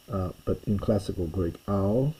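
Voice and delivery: ordinary talk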